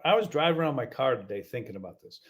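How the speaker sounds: usual speaking voice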